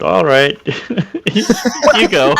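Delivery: giggly, halting hand-off; laughing. Acted. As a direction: break up while passing it on. Amused player voices awkward hand-off.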